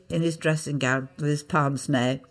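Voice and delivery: ordinary delivery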